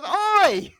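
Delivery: high, loud mock "Oi!"; attorney shouting. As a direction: shout it back like a bobby. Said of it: "And he goes".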